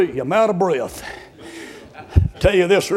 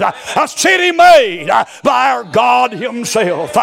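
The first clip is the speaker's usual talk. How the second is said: loud, slow, ringing preacher cadence; full-force preaching; 4 semitones higher, 4 dB louder, slower than usual. Real, not acted. Pastor preaching hard to the church, loud and ringing. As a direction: preach it out to the congregation. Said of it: "I'm preaching too hard".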